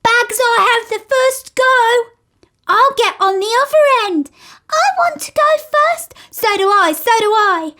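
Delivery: put-on high, loud eager children; children shouting to ride first; excited shouting. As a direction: clamour to go first. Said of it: "shouting with excitement".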